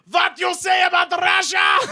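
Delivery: put-on high, drawn-out shout in a heavy Russian accent; thick Russian accent yell. Massive woman at end of bar overhears bartender, yelling in Russian accent. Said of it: "a massive woman with a thick accent yells".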